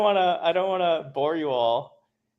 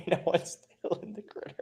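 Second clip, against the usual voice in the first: laughing whisper; 3 semitones higher, 10 dB quieter than usual. Real, not acted. Laughing aside about the dog.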